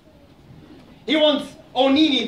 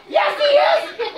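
much higher, loud indignant squeak; squeaky hedgehog; 4 dB louder, slower than usual. Acted. Hedgehog argues with Aso, a high indignant squeak. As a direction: protest in a tiny outraged voice.

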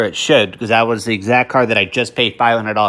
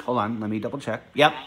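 ordinary talk

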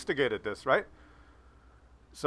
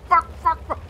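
high, loud mock swearing dad; 5 dB louder than usual. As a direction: spit out the curses like an angry old man.

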